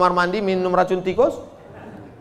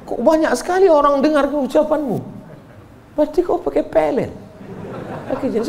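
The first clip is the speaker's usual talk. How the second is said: mock suspicious mother; 10 semitones higher than usual. Mother voiced, accusing.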